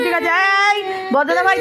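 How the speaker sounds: ordinary talk, nothing marked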